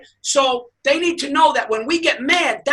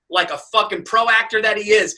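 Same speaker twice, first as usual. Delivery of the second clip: usual speaking voice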